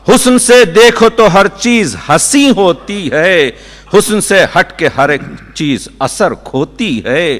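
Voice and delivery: measured nazm recitation, each line falling to rest; poetry recitation. Acted. Poet presenting his nazm on beauty to listeners, reciting. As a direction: open lines strongly, then let them settle.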